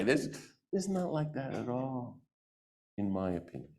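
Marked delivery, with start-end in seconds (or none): none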